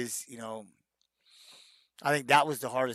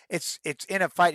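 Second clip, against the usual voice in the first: usual speaking voice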